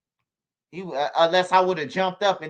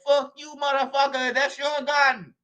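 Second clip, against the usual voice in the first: imitating the cab driver; 9 semitones higher than usual. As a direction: drag it out like the cornered driver cursing. Driver voiced in retold arrest.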